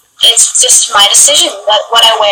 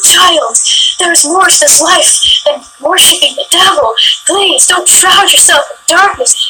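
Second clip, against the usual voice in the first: high-pitched imitation of a pleading zealot; religious man; 6 semitones higher than usual. Religious stranger confronting a goth shopper, imitated pious pleading. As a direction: plead like an overwrought preacher, mockingly. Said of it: "he was very religious".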